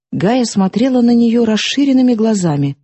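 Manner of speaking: usual speaking voice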